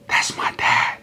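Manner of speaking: softly whispered recognition. Retold or imitated. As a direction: breathe it out, a child's hushed discovery. Child recognizes father's call.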